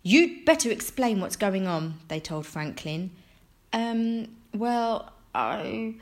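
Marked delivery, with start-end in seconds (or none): 0.0-2.0 loud, angry demand with a mild edge; angry parents. A narrator's reading. Parents confronting their son Franklin, angry. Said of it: "were very angry". 3.7-6.0 put-on halting, higher boy's stammer; hesitant stalling. A narrator's reading. Franklin answering his angry parents, hesitant.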